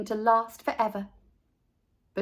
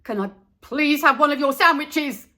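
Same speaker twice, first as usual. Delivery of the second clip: louder, higher impatient blurt; blurted impatiently; 5 semitones higher, 7 dB louder than usual. A narrator's reading. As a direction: burst it out, can't hold it in. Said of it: "Buster blurted impatiently".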